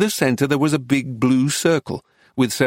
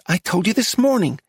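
high-pitched, insistent wife; shrill warning from a wife; shrill scolding; 7 semitones higher than usual. A narrator's reading. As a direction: correct him in a high voice. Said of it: "the wife shrilled".

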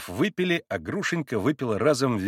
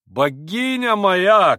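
loud, high, long-drawn cry; cried endearment; 8 semitones higher, 7 dB louder, much slower than usual. A narrator's reading. Pole on the sofa protests to Grushenka, crying out. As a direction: exclaim it ardently. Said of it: "крикнул пан на диване".

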